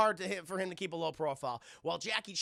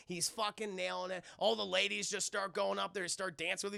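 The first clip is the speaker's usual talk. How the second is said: usual speaking voice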